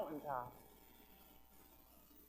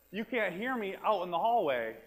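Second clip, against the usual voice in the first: normal talking voice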